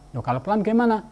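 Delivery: high, incredulous teacher question; mock surprise. Teacher challenging a shy reader, mock surprised.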